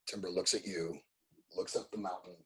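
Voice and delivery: ordinary talk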